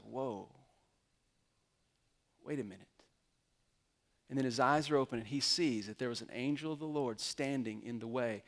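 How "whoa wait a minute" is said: hushed awe. Retold or imitated. Balaam suddenly sees the angel.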